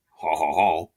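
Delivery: mock French "ha, ha, ha"; put-on chuckle in a French accent; French accent laugh. French agent after demanding a loan, mock French-accented laugh. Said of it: "my best French accent".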